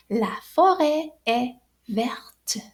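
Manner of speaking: slow, whispered verte with the T sounded clearly; pronounced final T. Shown as a demonstration. Teacher models the feminine verte, T pronounced. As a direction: say la forêt est verte slowly and sound the T of verte. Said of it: "So it sounds like this".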